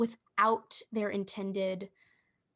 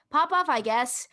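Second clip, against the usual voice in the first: higher, louder Southern-accented catchphrase; 5 semitones higher, 5 dB louder than usual. Shown as a demonstration. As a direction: demonstrate it punchy with a Southern accent. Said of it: "with a Southern accent".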